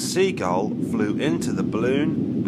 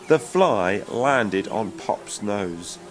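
plain reading voice

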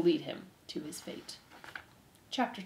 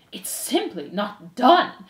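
slow, higher, scandalized voice; distinctive disapproving aunt; 4 semitones higher, 5 dB louder, much slower than usual. Acted. Aunt Florence objects from the crowd, distinctive and disapproving. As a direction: draw out each word in disapproval. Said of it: "came the distinctive voice".

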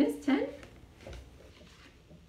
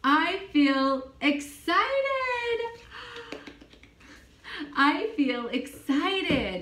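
excited; 8 semitones higher, slower than usual. Shown as a demonstration. Teacher showing a child an excited voice, higher and slow. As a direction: sell the thrill, slowly, up high.

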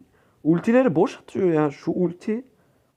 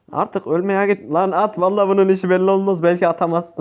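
ordinary talk, nothing marked

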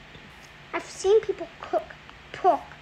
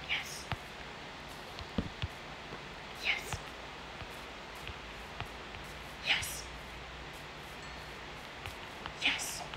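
soft whispered yes; 16 dB quieter, much slower than usual. Real, not acted. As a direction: hush it, barely voiced. Quietly celebrating the sheep.

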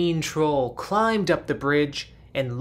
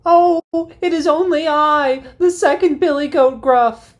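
much higher, squeaky goat voice pleading; a nervous middle goat begging the troll; second billy goat; 11 semitones higher, 7 dB louder than usual. Acted.

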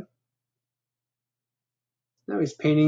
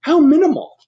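high, loud clipped art-snob remark; snooty Bat; 9 dB louder than usual. Acted. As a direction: sniff at it like a critic. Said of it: "says the Bat".

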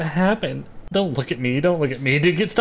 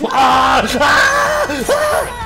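startled scream; 10 semitones higher, 5 dB louder, much slower than usual. Real, not acted. Screams as werewolf attacks.

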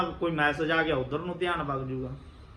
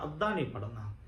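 ਅੱਧਾ said lower and much softer, a firm hushed warning; quiet insistent repeat; 5 semitones lower, 8 dB quieter than usual. Shown as a demonstration. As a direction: drop the voice low and soft on ਅੱਧਾ ਨਹੀਂ ਪੜ੍ਹਨਾ. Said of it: "ਉਹ ਵੀ ਸੰਪੂਰਨ ਕਰਨਾ". (ਅੱਧਾ ਨਹੀਂ ਪੜ੍ਹਨਾ ਨੀਵੀਂ ਤੇ ਧੀਮੀ ਆਵਾਜ਼ ਵਿੱਚ ਦ੍ਰਿੜ੍ਹਤਾ ਨਾਲ)

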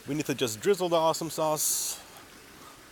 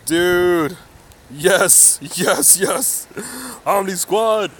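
higher, louder hyped calls; excited greeting; 5 semitones higher, 5 dB louder, much slower than usual. Real, not acted. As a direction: hype the squad as they roll in. Greeting arriving Omni Squad.

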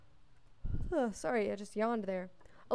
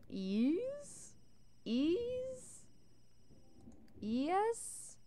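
high, puzzled questioning ease; confused uncle; 8 semitones higher, 5 dB quieter, much slower than usual. Retold or imitated. Imitating uncle fooled by the spelling trick, high and puzzled. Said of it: "He was like".

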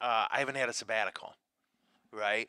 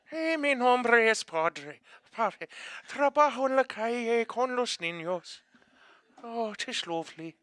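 put-on high, slow Irish-accented Spanish; Spanish put through a hammed-up Irish brogue; a priest showing off Spanish in an Irish lilt; 10 semitones higher, 4 dB louder than usual. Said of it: "I spoke Spanish with an Irish accent".

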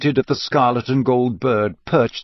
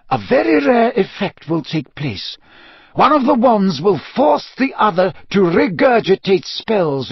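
put-on higher, deliberate old headmaster; emphatic lecturing in a raised pitch; Dumbledore; 7 semitones higher, 3 dB louder than usual. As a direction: expound it gravely, weighing each phrase. Voices Dumbledore explaining the effect.

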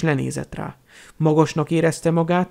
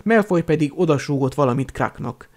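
plain reading voice

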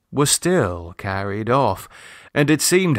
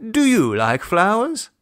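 higher, lightly voiced father, casually offhand; affected carelessness; 8 semitones higher than usual. A narrator's reading. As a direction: pretend not to care much as you ask. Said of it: "asked with affected carelessness".

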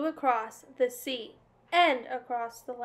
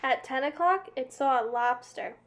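usual speaking voice

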